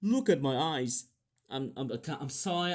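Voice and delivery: usual speaking voice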